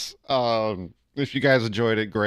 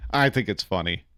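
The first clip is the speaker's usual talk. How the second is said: ordinary talk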